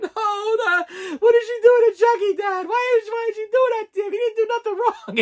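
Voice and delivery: put-on very high, loud, sobbing little girl voice; imitating crying daughter. Little daughter pleading with dad about Chucky, high teary whine. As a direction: play a small girl wailing over Chucky through tears. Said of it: "She's like".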